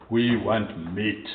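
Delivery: slow, low mock grumble; drawn-out demand from complaining Israelites; grumbling. Israelites complaining to God about food, slow mock grumble. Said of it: "They grumble".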